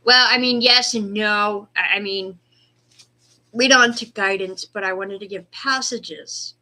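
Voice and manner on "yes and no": usual speaking voice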